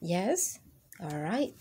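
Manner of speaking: ordinary delivery, nothing marked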